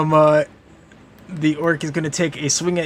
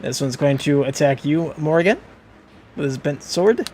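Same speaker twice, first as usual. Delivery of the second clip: ordinary talk, nothing marked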